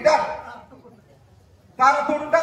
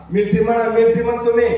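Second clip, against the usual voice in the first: loud command; 10 dB louder, faster than usual. Acted. Hypnotist drilling the crowd.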